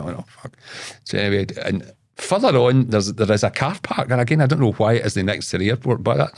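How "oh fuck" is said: voiceless, dismayed whisper; whispered oh fuck. Retold or imitated. Seeing a second stranger, a voiceless dismayed whisper. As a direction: breathe it out in quiet dismay.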